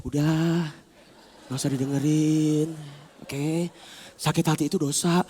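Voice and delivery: low, slow, soft counsel; gentle dissuading. Acted. Conscience soothing a hurt soul, slow and gentle. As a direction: soothe with drawn-out, quiet words.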